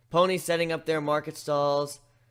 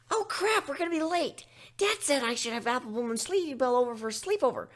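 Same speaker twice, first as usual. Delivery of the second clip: much higher hurried filly voice; Scootaloo voice; 11 semitones higher than usual. Acted. Scootaloo realizing she is late, high and hurried. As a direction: blurt it like a panicked kid.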